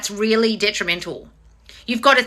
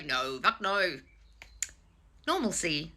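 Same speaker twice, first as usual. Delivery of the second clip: normal talking voice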